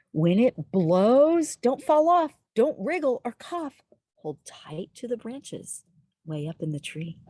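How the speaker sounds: lilting, sing-song read-aloud; reading to children. Acted. Storyteller reading a rhyming book to little listeners, sing-song. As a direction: read it like a bedtime rhyme.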